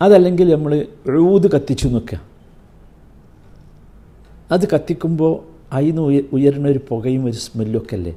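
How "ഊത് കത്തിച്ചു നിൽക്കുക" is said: normal talking voice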